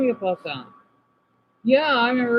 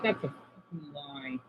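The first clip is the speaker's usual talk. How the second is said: ordinary talk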